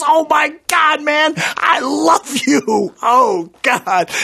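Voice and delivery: high, loud mock-adoring cries; imitating a cop losing it with excitement; starstruck gushing. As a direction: draw it out like an overcome fan.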